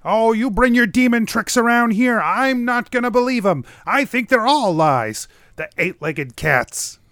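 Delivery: high-pitched scolding hermit character; zrzędliwy Vrath. Acted.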